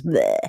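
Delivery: low "bleh" of playing dead; mock dying noise. Mother imitating her child refusing therapy, mock dying groan. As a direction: imitate someone pretending to drop dead. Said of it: "pretends that she's died and literally goes".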